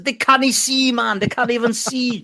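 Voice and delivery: high-pitched mock manager voice; comic impression pushed way up high; Bobby Robson. Host joins in imagining the England manager, high mocking impression.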